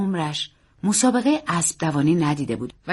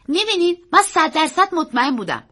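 put-on high, loud child's boast; victorious young nephew; triumphant boy; 9 semitones higher, 6 dB louder than usual. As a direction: crow to the uncle like a proud boy. Boy triumphant after winning.

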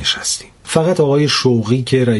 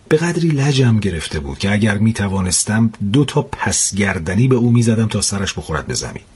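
plain reading voice

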